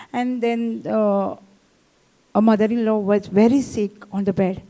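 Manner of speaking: usual speaking voice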